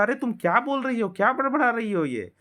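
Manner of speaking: put-on high, incredulous older sister; बड़ी बहन की ऊँची, झुंझलाई आवाज़; Susan's annoyed voice. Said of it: "Susan बोलता".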